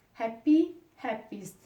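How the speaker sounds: ordinary talk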